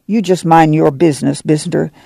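ordinary delivery, nothing marked

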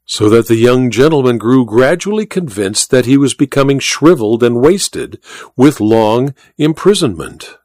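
usual speaking voice

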